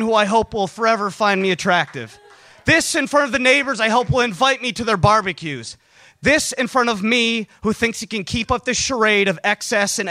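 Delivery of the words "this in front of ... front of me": plain reading voice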